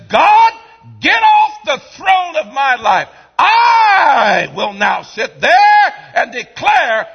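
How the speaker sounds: high, loud crying defiance; defiant Lucifer. Acted. Preacher voicing Lucifer defying God, high loud and crying. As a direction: shout God off the throne. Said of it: "you become Lucifer, who says".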